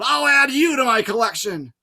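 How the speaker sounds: high, loud, furious snarl. A narrator's reading. Medusa snarling threats at Grover. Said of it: "she snarled".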